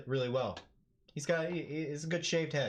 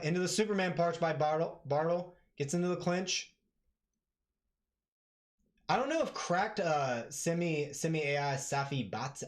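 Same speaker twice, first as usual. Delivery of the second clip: normal talking voice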